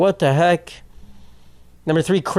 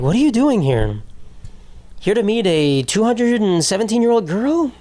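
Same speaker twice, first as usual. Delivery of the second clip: Chris Hansen voice; 6 semitones higher than usual. Retold or imitated. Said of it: "I wish I could do a Chris Hansen voice".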